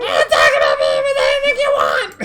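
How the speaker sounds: low, loud squawked bird talk; strange bird voice. Acted. Axe beak answering Stella, low loud squawk. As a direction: garble it like a parrot. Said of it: "I thought he said".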